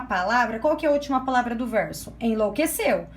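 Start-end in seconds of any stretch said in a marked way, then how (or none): none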